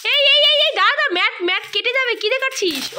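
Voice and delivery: loud, high-pitched urgent warning; alarmed calling out. Real, not acted. Stopping child cutting the mat.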